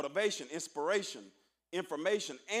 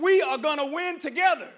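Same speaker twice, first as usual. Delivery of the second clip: higher and louder first try of the chant; rallying call; 7 semitones higher, 10 dB louder than usual. Shown as a demonstration. Speaker to the freshmen, first loud try of the chant. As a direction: lead the crowd in the line, loud and high. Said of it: "I want you to tell them".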